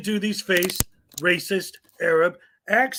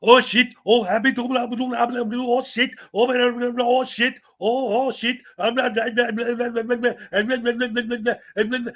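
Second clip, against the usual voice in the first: higher, hammed-up mock babble of blahs; mock gibberish imitation; 6 semitones higher, 3 dB louder than usual. Mocking auditors' fake babble, mimicking them. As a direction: parody their fake mumbling talk. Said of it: "they just mumble on like that".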